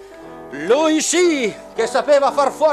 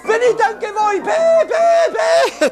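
very high shouting puppet; squeaky shrill puppet voice; 11 semitones higher than usual. Acted. Auguste the minister puppet.

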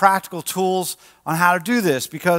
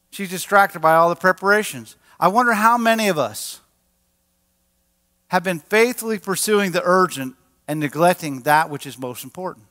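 ordinary talk, nothing marked

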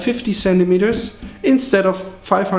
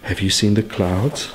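ordinary talk, nothing marked

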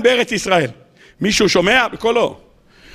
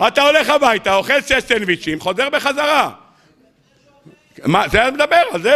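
normal talking voice

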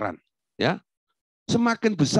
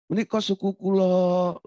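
raised, drawn-out courteous tone; refined Javanese politeness; 5 semitones higher than usual. Acted. Javanese pointing out their stepped-on foot, refined politeness. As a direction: imitate a very polite Javanese speaker. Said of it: "tapi omongannya halus".

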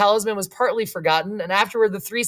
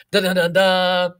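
grand, drawn-out da-da-da-da; mock piano fanfare on one held pitch; imitated piano chords; 4 dB louder, slower than usual. A narrator's reading. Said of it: "struck a few grand chords".

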